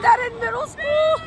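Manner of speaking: high panicky squeal about the ride; nervous squeal. Real, not acted. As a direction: squeak it out half scared. Dread of the ride.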